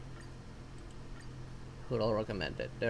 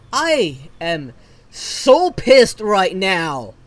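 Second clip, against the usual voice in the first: slow angry seethe; 2 semitones lower, 9 dB louder, much slower than usual. Real, not acted. After the bad pull.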